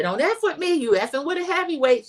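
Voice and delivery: high-pitched mock threat; feisty warning. Acting out Toni's angry warning.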